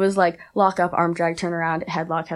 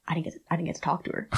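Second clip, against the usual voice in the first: anxious inner mutter; 2 semitones lower, 11 dB quieter, much faster than usual. Retold or imitated. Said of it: "I feel it in my stomach".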